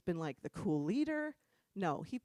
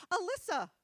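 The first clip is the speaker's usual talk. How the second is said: high, cheery mock-innocent answer; imitating naive friend; 9 semitones higher, 3 dB louder than usual. Friend gives creeps her name, mock-innocent. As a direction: chirp the name obligingly.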